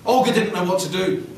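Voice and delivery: ordinary delivery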